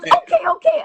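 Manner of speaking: very high, quick giving-in; Japanese accent. Retold or imitated. Japanese passenger backing down to the pilot, very high voice. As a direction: squeak it as the Japanese woman. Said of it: "And she goes".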